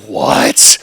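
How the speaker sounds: loud, breath-heavy stunned reaction; retold shocked disbelief. Retold shock at fire news.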